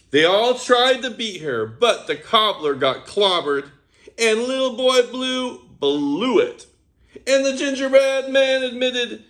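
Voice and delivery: plain reading voice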